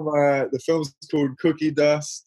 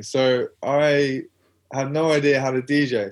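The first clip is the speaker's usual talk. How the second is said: ordinary talk, nothing marked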